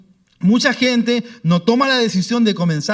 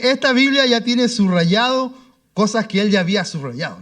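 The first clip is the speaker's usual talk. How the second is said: usual speaking voice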